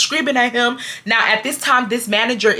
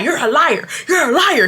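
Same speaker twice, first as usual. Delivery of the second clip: high-pitched taunting accusation; hammed-up finger-pointing jab; mock accusing; 5 semitones higher than usual. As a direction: imitate someone repeatedly pointing blame. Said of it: "he kept calling me a liar".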